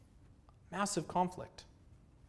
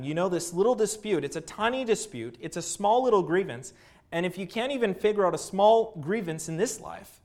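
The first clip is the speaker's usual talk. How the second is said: higher, louder, imploring reproof; raised-voice mock chiding; mild reproof; 5 semitones higher, 6 dB louder than usual. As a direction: chide them emphatically, as if quoting a letter. Preacher voices Paul chiding the Corinthians.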